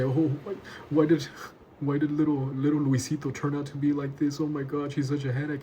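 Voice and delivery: high, fretful lament over a wayward son; a hammed-up worried parent wailing; exasperated parent. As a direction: whine like a fed-up parent. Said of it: "You might say".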